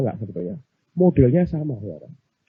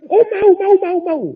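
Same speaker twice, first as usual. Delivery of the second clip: high, loud, eager rush of yeses; eager believer; 4 dB louder than usual. Acted. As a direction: jump at the offer in a high voice. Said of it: "orang Kristen kalau tanya begini". (antusias, suara tinggi)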